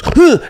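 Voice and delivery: sudden loud high "huh"; explosive mock punch noise; feigned punch grunt. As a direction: imitate a burst of effort that stops short. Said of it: "goes to throw a punch".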